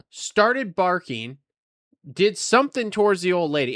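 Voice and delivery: ordinary talk, nothing marked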